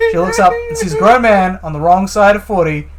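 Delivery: ordinary delivery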